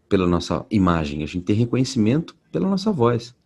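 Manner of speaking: normal talking voice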